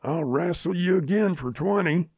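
a lightly played defiant yell at the dead lion; understated blustering shout; shouted challenge. A narrator's reading. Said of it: "shook his fist at the lion and shouted".

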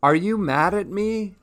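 high-pitched worried question; anxious call to a friend. A narrator's reading. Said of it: "She called out, to her friend".

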